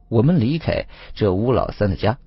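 usual speaking voice

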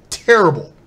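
building mock outrage. Repeating the word louder.

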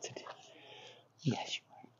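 very soft, unhurried whisper; whispered praise. Real, not acted. Trainer calming a dog, whispered praise.

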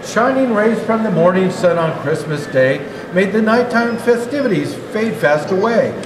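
plain reading voice

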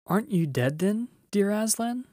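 put-on high, rising child's question; amazed question. A narrator's reading. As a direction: ask in hushed-up wonder, pitch climbing. Frightened, glad child questions Aslan.

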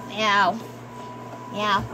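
low-pitched pretend meow; cat's meow. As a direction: meow low as a toy cat. Plays the cat.

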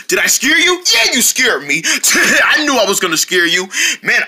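usual speaking voice

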